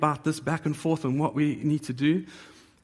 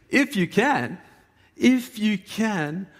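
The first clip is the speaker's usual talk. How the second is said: incredulous, mocking echo, slow and high; rising scornful echo of the father's doubt; sarcastic; 7 semitones higher, 4 dB louder, slower than usual. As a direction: throw their words back in disbelief. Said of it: "divine sarcasm".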